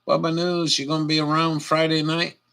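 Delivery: usual speaking voice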